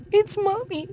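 put-on very high, small child voice; soft little girl. A narrator's reading. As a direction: say it softly as a tearful little girl. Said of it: "Lolly said softly".

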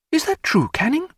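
very high, urgent woman's question; crying out. A narrator's reading. Diana confronting Canning over his wife, crying out. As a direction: cry it out high, demanding an answer. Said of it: "cried Diana".

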